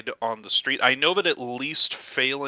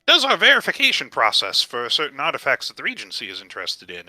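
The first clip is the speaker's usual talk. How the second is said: ordinary talk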